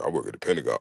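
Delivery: low, soft show-off line; lightly voiced bragging man. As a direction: murmur the job to impress her.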